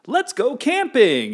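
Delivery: very high, slow, loud mock enthusiasm; overeager suggestion. Someone proposing an outing you hate, mock-eager call. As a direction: sing out the invitation, hammed-up. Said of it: "he's like".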